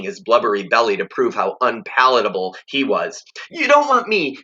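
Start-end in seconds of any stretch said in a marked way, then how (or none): 3.5-4.4 put-on high blubbering child's plea; sobbing plea. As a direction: sob it out as a desperate kid. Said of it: "he, he sobbed".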